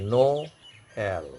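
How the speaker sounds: "no", clear pause, then "L" falling; pause before second part. Shown as a demonstration. Teacher summing up the tréma rule, "no", pause, "L". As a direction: hold "no" rising, stop, then drop on "L". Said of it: "you pause for a moment".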